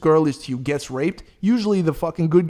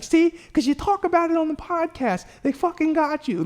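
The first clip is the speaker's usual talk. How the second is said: 2 much higher mock girlfriend voice, told-you-so; 3 play his girlfriend gloating, pitched way up; 1 imitating girlfriend; 13 semitones higher than usual. 4 girlfriend teasing him about the podcast, high mock voice. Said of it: "And she's like".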